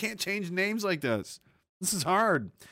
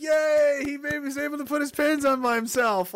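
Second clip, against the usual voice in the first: mock cheering; 8 semitones higher than usual. Mocking cheering crowd.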